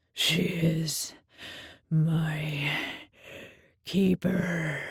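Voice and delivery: low, somber beast voice; low Minotaur voice. A narrator's reading. Minotaur naming his keeper, low and heavy. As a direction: say it deep and heavy.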